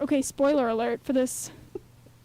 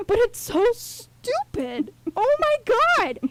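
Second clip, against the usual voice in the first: real high-pitched exasperated outburst; worked-up rant, climbing higher and louder; going off; 9 semitones higher, 3 dB louder, slower than usual. Real, not acted. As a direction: stretch it out in soaring, slow disbelief. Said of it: "I'm going off about this".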